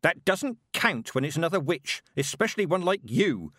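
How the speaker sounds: tetchy, emphatic retort; snapped. A narrator's reading. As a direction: snap it back at the girl. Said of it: "Mistress Weatherwax snapped".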